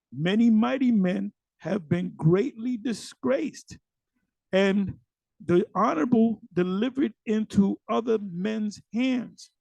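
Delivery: slow, emphatic verse reading; scripture reading. Acted. Teacher reading of mighty men disgraced, slow emphatic scripture reading. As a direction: proclaim the verse slowly, drawing out the phrases.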